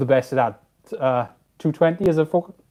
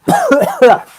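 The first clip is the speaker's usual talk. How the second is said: high laugh; 13 dB louder than usual. Real, not acted. Back pain, dust.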